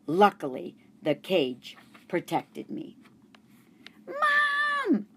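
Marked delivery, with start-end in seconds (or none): 4.1-5.1 put-on high girl's shout for mom; a girl yelling for her mom. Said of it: "Miranda yelled".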